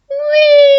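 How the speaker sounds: loud high-pitched wee swooping down; big excited slide wee. Shown as a demonstration. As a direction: squeal it out like the top of a slide. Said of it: "And we go again".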